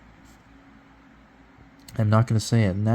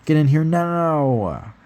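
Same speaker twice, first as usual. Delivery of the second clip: calling out; 4 semitones higher than usual. Acted. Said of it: "she called out".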